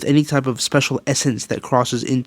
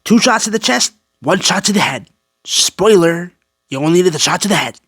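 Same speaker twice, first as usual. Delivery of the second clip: higher-pitched put-on quote voice; lightly hammed-up quote, higher voice; mock character quote; 5 semitones higher than usual. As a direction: mimic a game character's lines, pitched up. Mocking quote of game character.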